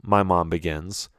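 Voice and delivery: plain reading voice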